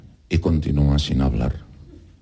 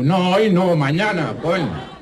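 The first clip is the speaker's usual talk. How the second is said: high exasperated scolding; 13 semitones higher than usual. Acted. Man scolds pig.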